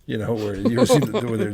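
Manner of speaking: ordinary talk, nothing marked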